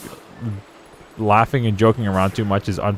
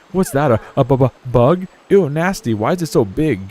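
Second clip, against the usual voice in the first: startled disgust; 4 semitones higher than usual. Real, not acted. Sees a big bug.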